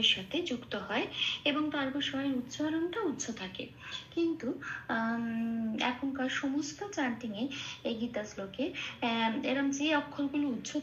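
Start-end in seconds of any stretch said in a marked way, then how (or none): none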